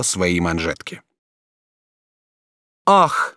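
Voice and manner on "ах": sharp high gasp of surprise, louder; a young woman caught off guard; испуганный вскрик; startled cry. A narrator's reading. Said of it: "вскричала Жервеза от удивления".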